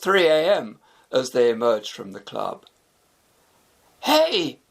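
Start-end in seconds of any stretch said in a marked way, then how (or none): 4.0-4.6 sudden high cry of surprise; startled exclamation. A narrator's reading. Shafi spotting the empty street, startled shout. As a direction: catch sight of something wrong. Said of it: "exclaimed Shafi".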